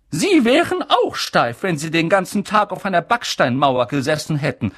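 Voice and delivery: high, fast and indignant; McGonagall, indignant. Acted. McGonagall answers Dumbledore, high and indignant. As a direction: snap back at the teasing. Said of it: "sagte Professor McGonagall".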